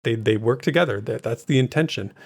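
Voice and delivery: ordinary talk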